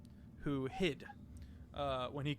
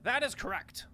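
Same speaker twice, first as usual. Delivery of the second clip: loud formal reply; 5 semitones higher, 5 dB louder than usual. Acted. Ambassador answering Lord Somber, loud and formal. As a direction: declare it firmly to the council.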